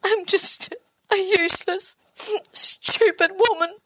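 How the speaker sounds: high, breaking sob through the words; crying. Acted. Self-blame breaks into crying.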